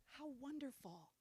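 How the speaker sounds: gently marvel, imitating a doting mother; imitating sweet mom. Daughter imitates mom's everyday talk, softly delighted. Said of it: "That's how she talks".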